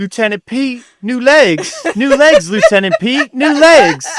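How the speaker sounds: high-pitched, hammed-up exclamation; mock movie quote. Joking to co-host about Pete's new legs, hammed-up movie parody.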